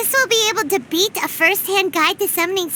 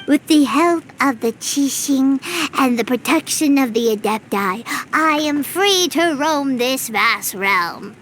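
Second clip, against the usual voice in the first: deep hero voice; 8 semitones lower than usual. Acted. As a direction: read it out as a lofty protagonist. Reading the draft in character.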